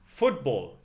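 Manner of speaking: "Football" with the emphasis on Foot; stress on first syllable. Shown as a demonstration. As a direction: hit the first syllable of "Football", then fall away. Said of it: "The emphasis is on the first syllable".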